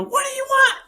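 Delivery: very high, frantic shriek; retold panicked scream at strangers at the door. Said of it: "I scream".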